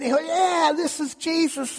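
high, eager proclamation; excited crowd. Retold or imitated. Crowd telling each other who comes, high excited. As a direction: announce him with delight. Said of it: "And, and".

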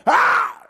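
sudden high, loud shriek; Satan's startled "Ah!"; startled scream. Acted. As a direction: yelp in shock at who is there. Satan startled at seeing Jesus.

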